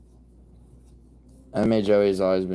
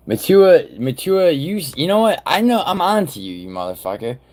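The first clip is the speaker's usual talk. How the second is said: much higher and louder, shouting at Matua; half-joking outraged shout; accusing yell; 9 semitones higher, 7 dB louder than usual. Real, not acted. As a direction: catch him out at full volume.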